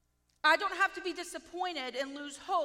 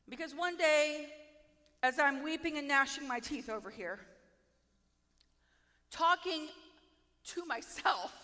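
ordinary delivery

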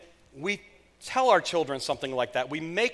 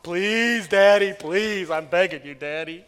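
put-on whiny begging child; 7 dB louder, slower than usual.

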